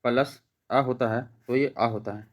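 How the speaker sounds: slow, deliberate teacher demo of आ plus आ; slow explaining. Shown as a demonstration. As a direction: spell out each vowel slowly for the class. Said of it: "तो आ, आ कैसे होता है दोस्तों?".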